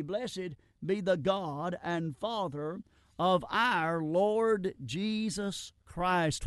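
slow, reverent reading of the verse; solemn scripture reading. Acted. Preacher reads the verse to his congregation, slow and reverent. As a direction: draw each word out like holy writ.

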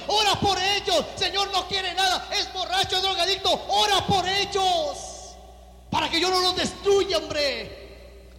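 loud, high preacher's plea; fervent preaching. Acted. Pastor urging the church to pray for the lost, fervent loud preaching. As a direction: call them to pray with force.

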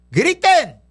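orden gritada, fuerte y aguda; grito de mando. Retold or imitated. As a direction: lanza la orden como un grito al pueblo. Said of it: "le dijeron, gritaron". (grito de mando enérgico)

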